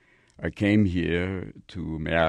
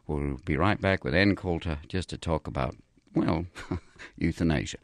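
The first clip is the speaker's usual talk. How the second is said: 1 mock radio host; 3 semitones higher, 6 dB louder than usual. Mock radio show host bit.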